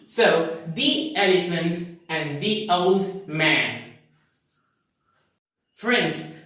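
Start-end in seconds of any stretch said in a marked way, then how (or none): 0.7-3.9 both "the" like "dee" before the vowels; "the" as thee. Shown as a demonstration. Teacher sums up both examples, "the" as thee. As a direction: say "the" with an ee before "elephant" and "old". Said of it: "you have to pronounce this word as".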